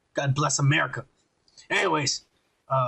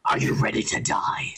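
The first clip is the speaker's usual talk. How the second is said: menacing whispered threat; badass villain voice. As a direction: whisper it like a masked movie villain. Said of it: "a better actor with a badass voice".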